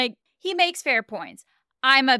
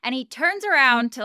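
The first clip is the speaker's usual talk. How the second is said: normal talking voice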